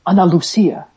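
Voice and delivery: "Ana Lucia" said with Spanish vowels; Spanish pronunciation. Shown as a demonstration. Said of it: "I said that like that 'cause I'm half Mexican".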